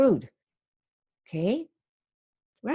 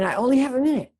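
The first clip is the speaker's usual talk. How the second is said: "only" lifted, then falling away on "minute"; sales-script tune, peaking before the final fall; demonstrated intonation; 7 semitones higher, 3 dB louder, faster than usual. Shown as a demonstration. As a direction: model the phone line with a lift on "only". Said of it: "And you have that intonation".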